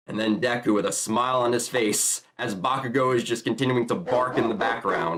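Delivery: ordinary talk, nothing marked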